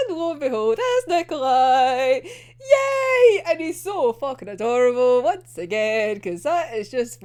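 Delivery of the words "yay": high, loud squealed yay; cheering. Real, not acted. Fan cheering as her favourite character appears, squealing.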